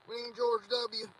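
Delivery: alarmed pleading shout. Real, not acted. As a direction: demand help in a panic.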